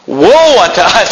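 high, drawn-out cry of dismay; mock crying out. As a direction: act out people wailing in dread. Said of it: "crying out, saying".

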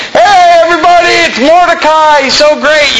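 high, loud herald's cry, straining; forced public shout. Retold or imitated. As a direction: shout it across the town square, mock-proud. Haman forced to praise Mordecai.

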